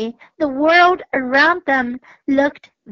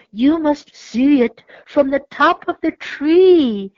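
higher, louder owl voice; 4 semitones higher, 5 dB louder than usual. A narrator's reading. Owl inviting the cat and mouse up, owl voice. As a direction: coax them up the tree. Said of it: "said the owl".

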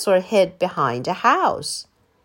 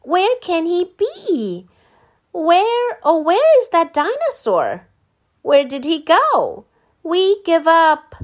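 mock searching; 9 semitones higher, 6 dB louder than usual. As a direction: ham up looking everywhere. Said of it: "Let's make believe".

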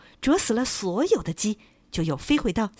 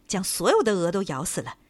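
ordinary delivery

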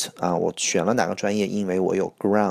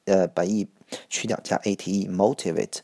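normal talking voice

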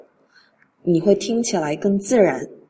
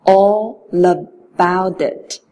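slow linked phrase; 4 dB louder, much slower than usual. Shown as a demonstration. Teacher modelling the three-word link slowly. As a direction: stretch it out, all linked. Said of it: "三个词连读".

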